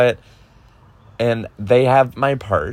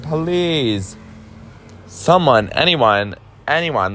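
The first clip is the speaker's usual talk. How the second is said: higher-pitched retold plea; pleading; 5 semitones higher, slower than usual. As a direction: call out for anyone to help. Retold begging police for help.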